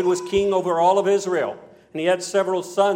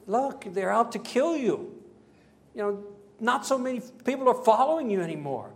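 high-pitched incredulous appeal; acted exasperated questioning, high throughout; baffled protest; 6 semitones higher than usual. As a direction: plead with him to see sense, pitched up. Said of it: "maybe the disciples were the same way with Jesus".

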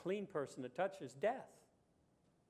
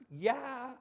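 higher, louder, mildly incredulous disciple; a hint of Peter pushing back at his teacher; 5 semitones higher, 6 dB louder than usual. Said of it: "Peter goes".

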